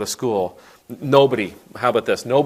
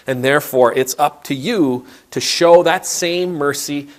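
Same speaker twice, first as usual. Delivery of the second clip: preaching at full force; 5 semitones higher, 5 dB louder than usual. Acted. Preacher's climactic exhortation.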